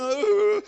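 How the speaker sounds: high, loud uncertain uh; a nervous little hesitation; a boy balking at the door; hesitant child's sound. Retold or imitated.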